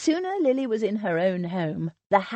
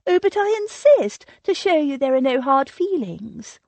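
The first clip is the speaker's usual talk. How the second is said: much higher and louder, bubbling insistence; insistent gush; 7 semitones higher, 6 dB louder than usual. A narrator's reading. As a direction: steamroll her refusal with bright insistence. Sylvia insisting.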